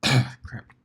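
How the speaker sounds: hoarse after a cough; rough, dry-throated word. Real, not acted. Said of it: "Throat's been a little dry".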